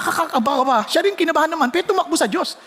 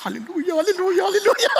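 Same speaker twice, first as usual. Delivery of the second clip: quiet laughing praise; 4 semitones higher, faster than usual. Retold or imitated. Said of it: "tahimik lang ako".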